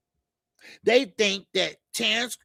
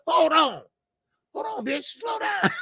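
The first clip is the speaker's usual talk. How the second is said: retold yell; 9 semitones higher than usual. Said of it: "I said".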